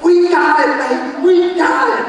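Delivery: high, thrilled shout of good news; drawn-out triumphant cry; elated. Retold or imitated. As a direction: burst out with joy to his wife. Jumping in truck, telling wife.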